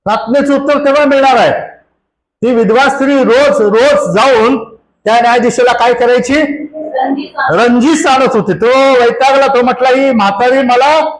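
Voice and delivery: high-pitched, driving sermon delivery; impassioned preaching. Real, not acted. (उंच स्वरात जोशपूर्ण प्रवचन)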